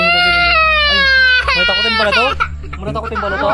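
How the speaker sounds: squealing high, hammed-up fear; shrill mock fright. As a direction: squeal like a scared kid. (matinis na kunwaring takot)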